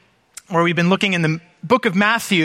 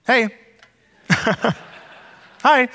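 light, offhand, higher hey-hi with a laugh; casual understated greeting; 5 semitones higher than usual. Retold or imitated. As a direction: greet like it's nothing special. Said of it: "it's so understated".

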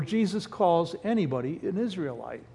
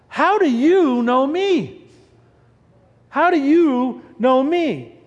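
amazed; 4 semitones higher, 3 dB louder, slower than usual. Acted. Nathanael amazed at Jesus.

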